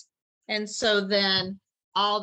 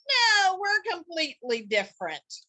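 indignant animals; 9 semitones higher, 4 dB louder than usual. Acted. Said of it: "And they're like".

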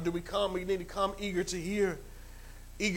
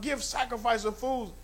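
ordinary delivery

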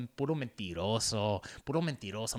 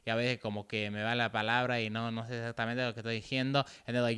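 usual speaking voice

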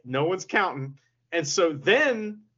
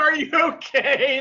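higher, louder teasing voice; villain faking worry; mock concern; 8 semitones higher, 5 dB louder than usual. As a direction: ask it like a smug big brother. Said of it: "He's like".

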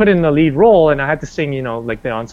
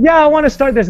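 excited retelling of own eagerness; higher and louder with keen enthusiasm; eager; 11 semitones higher, 4 dB louder, faster than usual. Retold or imitated. Eager retold self, raised pitch.

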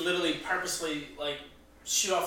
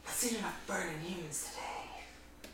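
whisper; 11 dB quieter than usual. Acted. Creepy character line.

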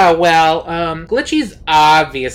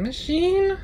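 incredulous question. Real, not acted. Doubtful guess at the plan.

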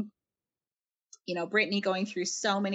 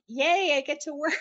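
cheery mock yay; 7 semitones higher, 3 dB louder than usual. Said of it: "Usually I'm like".

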